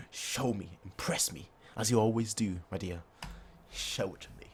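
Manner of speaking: hushed, fading villain taunt, ending near a whisper; breathy hushed taunt; soft villain voice. Acted. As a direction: lean in and taunt softly. Voicing seductive villain.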